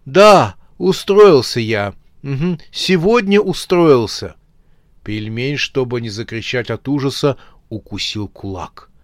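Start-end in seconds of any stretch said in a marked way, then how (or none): 0.1-4.4 loud, higher everyday phone voice; phone chat. A narrator's reading. Dead man on the phone from his grave, loud casual chat. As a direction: chat casually and loudly as if on a call. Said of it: "так, как обычно разговаривают по телефону".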